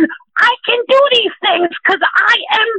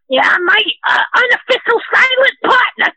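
ordinary talk

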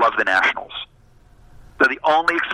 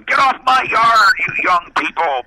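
mock an old man shouting kids off the yard; hammed-up cranky old-timer holler; grumpy old man; 8 semitones higher than usual. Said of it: "do I sound like the old man going".

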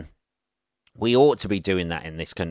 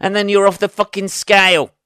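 loud, high-pitched flare-up; mock outburst; 12 semitones higher, 8 dB louder than usual. Describing Glaswegians getting upset, mock-aggressive outburst. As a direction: blow up, hammed-up and comic.

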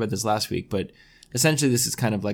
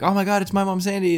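excited fan; 9 semitones higher than usual. Retold or imitated. Fans recognizing host at restaurant.

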